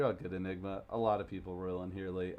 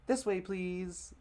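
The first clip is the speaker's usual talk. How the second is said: put-on a slow, higher little-girl voice; twins' voice; 10 semitones higher, much slower than usual. Twin girls showing guests to the table, slow and high. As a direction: lead guests like a tiny hostess. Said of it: "they're both gonna get the same voice".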